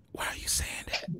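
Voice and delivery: whispered, no voice at all; urgent whisper. Retold or imitated. Pleading with Larry on live air, whispered. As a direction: hush it like the mic is still live. Begging Larry to stop talking.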